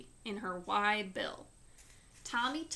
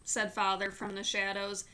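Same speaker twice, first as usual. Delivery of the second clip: ordinary delivery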